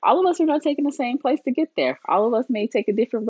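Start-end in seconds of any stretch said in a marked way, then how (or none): none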